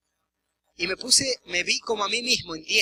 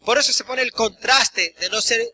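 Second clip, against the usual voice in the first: full-force preaching; 5 dB louder than usual. Acted. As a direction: preach it out to the congregation, hammering every point.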